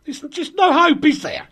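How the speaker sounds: high, loud exasperation; exasperated. Real, not acted. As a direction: throw up your hands in despair. Exasperated at cohost's ignorance.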